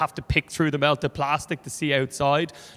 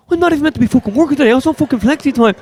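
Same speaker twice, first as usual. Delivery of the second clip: fast, loud, high-pitched protest; arguing Dublin voice; 10 semitones higher, 10 dB louder, much faster than usual. Acted. Worker protesting he's on flexi time, rapid high arguing. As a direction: rattle off an indignant muffled gripe. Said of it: "two muffled Dublin voices in the background arguing".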